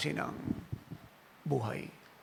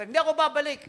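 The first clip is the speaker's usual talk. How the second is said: higher, louder refusal; mock angry wife; wife shouting back; 10 semitones higher, 7 dB louder than usual. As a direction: yell defiantly.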